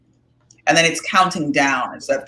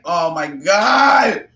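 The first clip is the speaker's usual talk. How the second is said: mock panic; much slower than usual. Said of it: "Doesn't it make you a little like".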